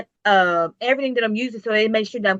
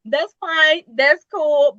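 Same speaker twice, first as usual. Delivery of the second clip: mock unbothered; 5 semitones higher, 6 dB louder, much slower than usual. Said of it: "I'm like".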